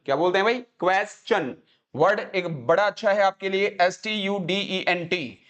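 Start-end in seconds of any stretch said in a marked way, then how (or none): 0.5-1.6 slow क्वेश्चन, KWES-chun; correct pronunciation. Shown as a demonstration. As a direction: drill क्वेश्चन slowly with 'kwe'. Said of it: "इसको बोलते हैं क्वेश्चन". (क्वेश्चन, धीरे से 'क्वेस-चन')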